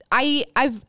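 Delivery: ordinary talk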